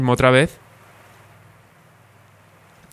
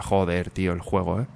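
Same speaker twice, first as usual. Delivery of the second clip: low, soft grumbling curse; cursing under breath; 6 semitones lower, 8 dB quieter than usual. Real, not acted. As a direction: grumble it, fed up. Complains about the game, softly. (refunfuñando en voz baja)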